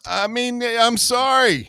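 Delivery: high, slow fake apology; mock apology. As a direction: pretend to be sorry in a high voice.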